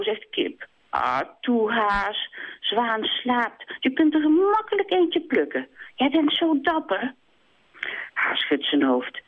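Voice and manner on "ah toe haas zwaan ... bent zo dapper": higher, pleading hen flattering him; coaxing hen. Acted. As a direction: beg and flatter at once. Kip pleading.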